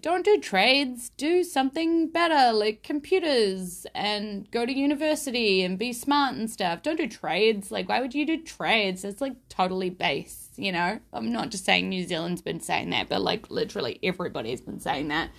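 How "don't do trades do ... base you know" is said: mocking voice. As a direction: lecture them in a snooty high voice. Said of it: "telling everybody, like".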